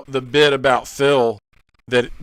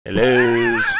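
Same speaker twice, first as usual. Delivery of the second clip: imitated movie catchphrase; 3 semitones lower than usual. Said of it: "He does say that a lot in this movie".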